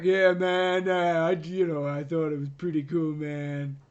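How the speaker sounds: a lightly voiced laid-back hippie. Friend imitating the actor's hippie voice, lightly. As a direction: drawl it out like a stoner, only lightly. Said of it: "a really hippie voice going on there".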